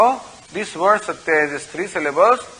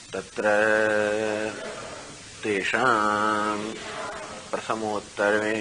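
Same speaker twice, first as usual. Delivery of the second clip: low, softer monotone chant with long pauses; Sanskrit recitation; 8 semitones lower, 11 dB quieter, much faster than usual. Acted. Teacher reciting the Shruti passage he explains, in low monotone chant. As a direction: intone it on one low note, drawing out phrase endings.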